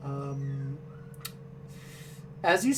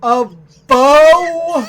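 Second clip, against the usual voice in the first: much louder, higher bow; incredulous yelp; 7 semitones higher, 11 dB louder than usual. Acted. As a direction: bark the word in disbelief. Shopkeeper mishears the order.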